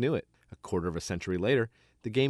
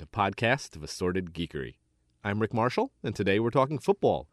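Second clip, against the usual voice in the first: ordinary talk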